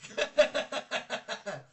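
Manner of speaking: laughter. Real, not acted. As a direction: crack up out loud. Laughing at a snack guess.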